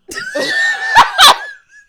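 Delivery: a shrieking, high laugh; loud burst of laughter. Real, not acted. Cohost laughs at her mom's comment about walking, loudly. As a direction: explode into helpless laughter.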